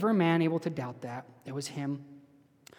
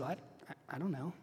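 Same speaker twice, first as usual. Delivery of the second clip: sheepish thief; 15 dB quieter than usual. Retold or imitated. Thief answers angel, retold.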